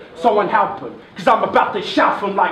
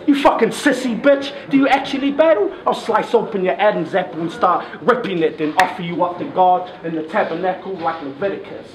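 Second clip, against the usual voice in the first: ordinary delivery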